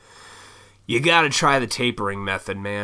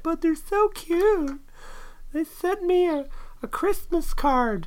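much higher, slow, gushing voice; mock swooning justifier; slower than usual. Mimicking someone gushing over a bad partner, high and slow. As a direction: coo it like a smitten fool. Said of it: "you justify it".

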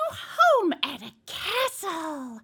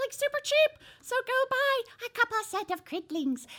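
ordinary delivery

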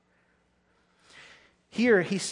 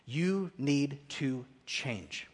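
slow soft urging; 3 semitones lower, 7 dB quieter, much slower than usual. Real, not acted. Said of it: "quiet urgent aside".

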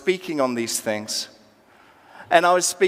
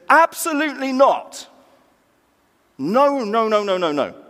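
high, loud refusal that tumbles down; emphatic denial; 6 semitones higher, 4 dB louder than usual. Acted. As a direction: reject the idea outright as an acted apostle. Acted emphatic denial by Paul.

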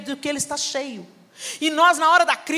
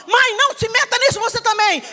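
higher, louder indignant daughter; angry retort; 9 semitones higher, 4 dB louder than usual. Retold or imitated. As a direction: snap back at mom. Daughter yells at mother.

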